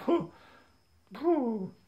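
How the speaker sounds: high, loud "whew"; amazed whoop. Real, not acted. Amazed at being remembered.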